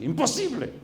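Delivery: very high-pitched exclamation of refusal; indignant refusal. Retold or imitated. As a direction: act out her outraged refusal at the top of the range. Woman repeats her refusal, retold.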